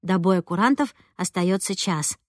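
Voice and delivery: ordinary delivery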